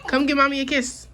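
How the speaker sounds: high, bright ask for a kiss; coaxing mom voice. Real, not acted. Mom asking toddler for kisses.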